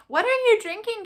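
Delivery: high, loud grandma voice prying; suspicious grandma. Retold or imitated. Grandma questioning her drink, high and prying. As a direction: mimic grandma asking pointedly. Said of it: "she was like".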